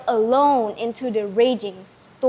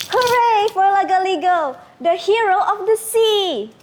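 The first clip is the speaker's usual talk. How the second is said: much higher, louder cheer; joyful cheering; 8 semitones higher, 4 dB louder than usual. Acted. Villagers cheer Lagaligo after the battle, jubilant. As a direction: celebrate the hero joyfully. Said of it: "cheering and crying with joy".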